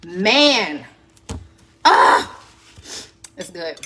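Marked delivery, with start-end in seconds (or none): 1.8-2.4 sharp gasp. Real, not acted. Reacting to spicy chicken.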